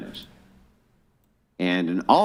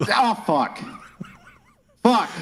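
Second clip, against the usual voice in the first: high, strained curse; effortful, exasperated outburst; struggling; 3 dB louder than usual. Real, not acted. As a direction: curse mid-struggle with a stuck jar. Struggling with olive jar prop.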